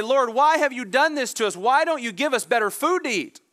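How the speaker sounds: complaining Israelites. Acted.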